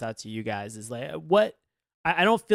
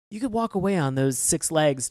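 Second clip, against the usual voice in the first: ordinary delivery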